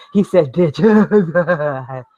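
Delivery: laughing through the words; mock giggling audience. Vlogger imitating his easily amused audience, mock laughing. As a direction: drag it out into exaggerated laughter.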